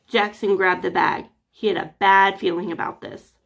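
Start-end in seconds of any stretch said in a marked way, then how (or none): none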